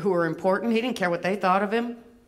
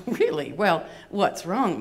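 ordinary talk, nothing marked

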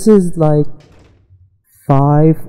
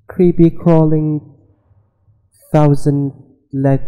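ordinary talk

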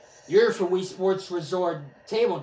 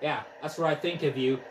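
ordinary talk

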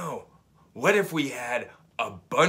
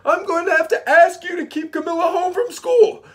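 high, loud put-on principal voice; principal's voice; 12 semitones higher, 9 dB louder than usual. Principal phoning the mother, high and loud. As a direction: play the fussy principal, pitched way up.

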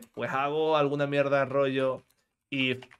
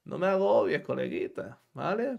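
much higher, soft mock calming; mock soothing; 4 semitones higher, 4 dB quieter than usual. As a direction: hush the whining code like a fussy child, up high. Soothing the complaining code.

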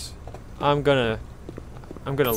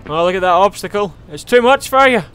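loud, high mock concern; 4 semitones higher, 7 dB louder than usual. Real, not acted. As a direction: tease him, louder and higher. Mocking NPC at obstacle.

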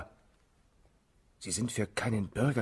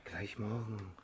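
much softer, unvoiced whisper; 4 semitones lower, 14 dB quieter than usual. Baron whispers softly to win Marie over. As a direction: lean close and whisper her name.

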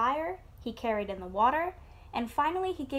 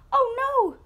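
loud, high-pitched piglet scream; storybook shriek of dismay; 12 semitones higher, 9 dB louder than usual. A narrator's reading. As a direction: cry out in alarm, high and loud. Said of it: "screamed the piglet".

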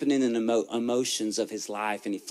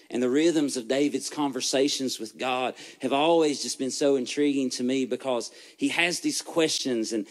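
ordinary talk

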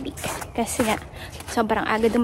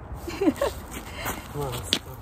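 soft laugh; 8 semitones lower, 10 dB quieter than usual. Real, not acted. Laughs at husband off camera.

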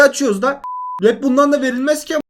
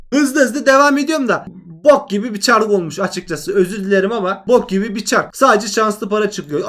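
ordinary delivery

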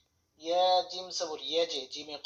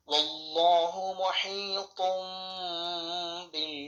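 slow, melodic tajweed chant; Quran recitation; 3 dB louder, much slower than usual. Acted. Teacher reciting the finished verse in tajweed style. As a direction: recite slowly with long held vowels.

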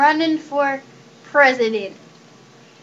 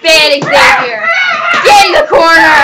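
angry shout; 6 dB louder than usual. Real, not acted. Owner yelling at Xavier to go to the corner, shouting. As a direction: bellow the order across the room.

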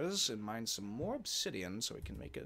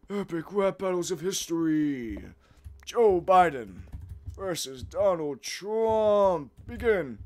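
announcer voice; 8 semitones higher, 10 dB louder, slower than usual. Pretend. Announcing a mock rap battle.

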